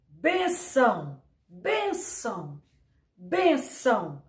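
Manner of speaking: benção slowly, leaning on ben; stress on BEN. Shown as a demonstration. Teacher repeating benção slowly to show that ben is the strong syllable. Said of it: "o ben sai com mais intensidade".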